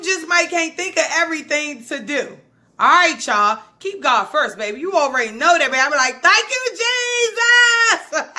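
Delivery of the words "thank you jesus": high, drawn-out cry of thanks; praise shout. Acted. Believer thanking Jesus, high jubilant shout. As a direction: holler it out slowly like church praise.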